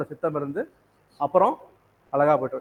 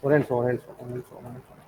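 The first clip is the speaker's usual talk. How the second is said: muttering to self; 6 semitones lower, 7 dB quieter, faster than usual. Real, not acted. Teacher searching verse, muttering to self.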